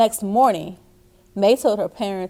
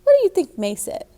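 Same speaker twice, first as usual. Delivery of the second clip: playful question; 9 semitones higher, faster than usual. Real, not acted. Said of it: "reader asks listening children".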